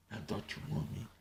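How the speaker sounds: unvoiced mocking whisper. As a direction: breathe it with no voice. Whispered second take.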